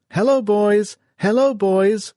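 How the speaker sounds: high, loud repeated cry; wounded man crying out. A narrator's reading. Wounded man calls out again in the bunker, high loud cry. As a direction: cry out blindly from the delirium. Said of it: "cried the wounded man".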